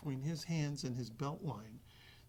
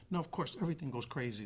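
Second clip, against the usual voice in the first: normal talking voice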